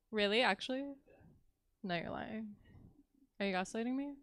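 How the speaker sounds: ordinary delivery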